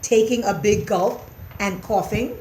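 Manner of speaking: usual speaking voice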